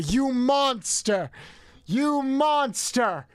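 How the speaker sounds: loud, slow dramatic accusation; mock outrage. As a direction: drag out the outrage.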